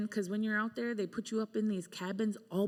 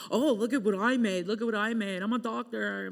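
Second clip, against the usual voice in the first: mocking boast; 2 semitones higher, 5 dB louder than usual. Mocking boastful fellow artists.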